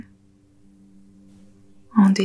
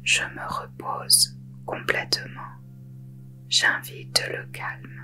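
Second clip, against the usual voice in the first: whispered; 8 dB quieter than usual. Shown as a demonstration. Teacher repeats the intention for listeners to say inwardly, whispered.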